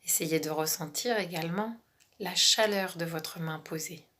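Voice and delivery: calm relaxation guide, half whispered; guided meditation. Acted.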